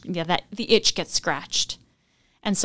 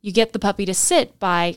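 ordinary delivery

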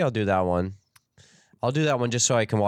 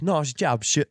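put-on higher, botched British accent; 5 semitones higher, slower than usual. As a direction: attempt a British accent and stumble. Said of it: "that was a cool accent".

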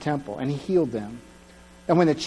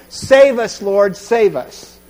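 loud pleading cry; 3 semitones higher, 7 dB louder, much slower than usual. Retold or imitated. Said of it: "they're saying".

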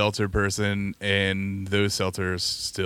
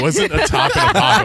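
laughing; 8 dB louder than usual. Real, not acted. Laughing while telling the story.

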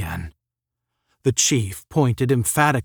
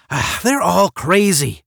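higher, louder, disgusted outburst; fed-up scorn, voice raised; disgustedly; 8 semitones higher, 5 dB louder than usual. A narrator's reading. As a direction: dismiss them all in exasperation. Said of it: "Randolph said disgustedly".

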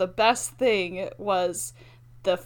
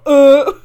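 loud grunt; 4 semitones higher, 16 dB louder than usual. Retold or imitated. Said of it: "you usually just go".

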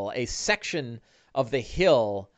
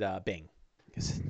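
ordinary talk, nothing marked

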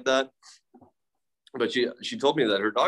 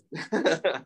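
short laugh; 6 semitones higher than usual. Real, not acted. Laughs at his own confession.

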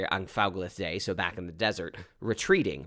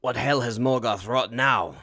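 stern elf king; 3 semitones higher, 5 dB louder than usual. Acted. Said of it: "Fingolfin says".

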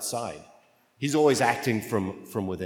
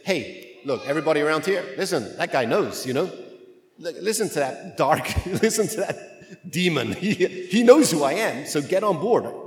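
hammed-up eager crowd-pleaser, higher and louder with a laugh; mock excited announcement; 5 semitones higher, 5 dB louder than usual. A pretend Jesus promoting the demon's testimony to everyone, hammed-up and excited. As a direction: sell it to the crowd like a giddy showman. He doesn't say.

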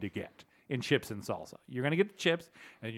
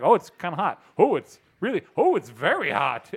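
loud, pained gasps as the heat hits; mock reaction to very hot salsa; burning mouth; 5 semitones higher, 8 dB louder than usual. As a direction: act out a mouth on fire, louder on each oh.

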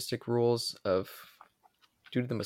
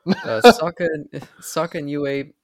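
loud; 4 semitones higher, 12 dB louder, slower than usual. Real, not acted. Drunk reader restarts line loudly.

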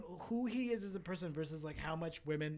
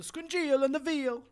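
loud cartoon Italian accent; Italian chef boasting about the veal; 8 semitones higher, 9 dB louder than usual. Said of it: "Italian accent".

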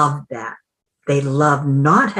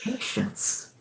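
breathy high whisper; whispered reply; 8 semitones higher, 13 dB quieter than usual. Retold or imitated. Voicing the mind, she whispers a calm reply to herself. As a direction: hush them with a single calm whisper. Mind's soft answer to impatience.

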